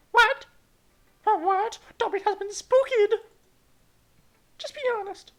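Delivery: squeaky outraged falsetto; shrill loud girl. Acted. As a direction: shriek it in a high girl voice.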